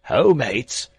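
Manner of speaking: loud rough sailor's hail; rowdy seaman's call; sailor crying out. A narrator's reading. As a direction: bellow it across the beach to the mates. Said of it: "he cried".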